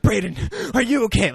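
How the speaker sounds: high, hammed-up distressed call; imitating a frantic, worried friend; mock war-movie panic. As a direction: cry out like a melodramatic war film. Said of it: "as if it's, like, Saving Private Ryan".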